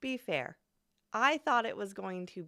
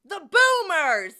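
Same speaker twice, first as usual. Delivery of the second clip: slow, loud, high-pitched ranting wail; mock boomer rant; 11 semitones higher, 8 dB louder than usual. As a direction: wail about the boomers like a caricature. Mock bigot ranting about boomers.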